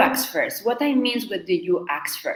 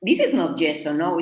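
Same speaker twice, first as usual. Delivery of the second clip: ordinary talk, nothing marked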